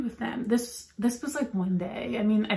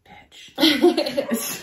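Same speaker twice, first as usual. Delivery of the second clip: laughing; 4 semitones higher, 3 dB louder than usual. Real, not acted. Laughing at the barking dog.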